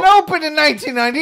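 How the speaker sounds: fast, loud, high teasing outburst; excited exclamation. Real, not acted. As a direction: blurt it out, rushing.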